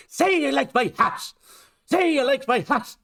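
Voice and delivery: high-pitched mock Irish accent; bad Irish accent. Mimicking Tom Cruise's line after the clip, bad Irish accent. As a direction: parody a terrible film attempt at an Irish accent. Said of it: "the worst attempt at an Irish accent".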